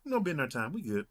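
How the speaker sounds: high, casual uncle impression; easygoing imitation of an uncle; uncle's tone. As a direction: shrug it off in your uncle's high voice. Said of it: "The tone I'm giving you, that's how he give me".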